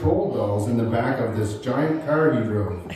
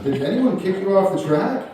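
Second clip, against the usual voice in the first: higher, laughing through the question; 8 semitones higher, 4 dB louder than usual. Real, not acted. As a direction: ask it while cracking up. Retelling asking granddad, laughing.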